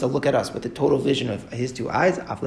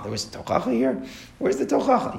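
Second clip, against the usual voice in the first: incredulous question; 10 semitones higher, 3 dB quieter than usual. Real, not acted. Rhetorical challenge to the Gemara.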